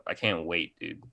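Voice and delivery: ordinary talk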